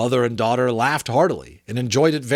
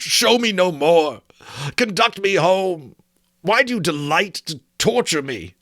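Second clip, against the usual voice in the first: anguished plea; 6 semitones higher, 3 dB louder than usual. Acted. Scrooge begging the Ghost to take him home, anguished. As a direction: beg the spirit to stop.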